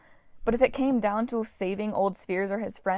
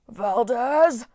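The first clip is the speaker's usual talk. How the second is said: warbling call; 3 semitones higher, 5 dB louder than usual. A narrator's reading. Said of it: "called Wolfhead in a warbling voice".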